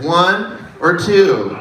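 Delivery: very slow, drawn-out options; slow imitation of an optometrist; optometrist's test question. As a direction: stretch each choice out as in an eye test. Acts optometrist offering a choice.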